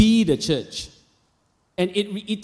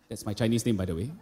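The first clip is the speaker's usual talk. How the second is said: ordinary talk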